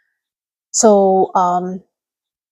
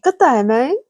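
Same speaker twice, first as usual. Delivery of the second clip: a light Australian good day, mate; a cheery, lightly done Australian good day, mate; Aussie greeting; 3 semitones higher than usual. Shown as a demonstration. Streamer greeting viewer Shadow Mark in a light Australian accent. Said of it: "Shadow Mark three".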